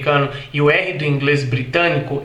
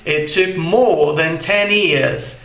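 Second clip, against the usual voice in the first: slow British "more" with no R; British English, no R in "more"; British accent; much slower than usual. Shown as a demonstration. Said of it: "E isso no inglês britânico". (inglês britânico lento, sem R)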